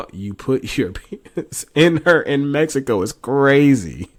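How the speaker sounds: loud, laughing, slowed-down disbelief. Real, not acted. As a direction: blurt it out in shocked amusement. Reacting to shocking texts.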